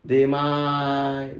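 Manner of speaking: long drawn-out beggar's singsong; begging beggar. Acted. Beggar calling out for alms in the street, drawn-out begging. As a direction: plead for alms in a slow chant. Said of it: "भिकाऱ्यासारखा भीक मागत होता".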